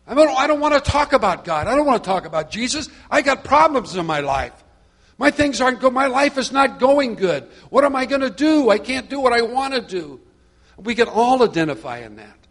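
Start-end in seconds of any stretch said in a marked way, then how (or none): 0.1-10.2 loud, high imitation of someone overwhelmed; stressed outburst. Preacher acting out a stressed woman's refusal, loud distressed rant. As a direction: yell the complaints as she would. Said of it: "she was in a period of stress".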